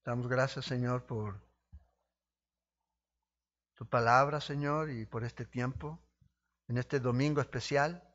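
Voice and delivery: earnest pastor's prayer to God. Acted. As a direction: pray aloud to the Lord for the congregation. Leads a prayer. (oración pastoral sincera)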